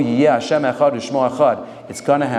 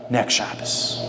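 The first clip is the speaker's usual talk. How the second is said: hushed; 5 semitones lower, 6 dB quieter, slower than usual. Retold or imitated. Rebbe answers.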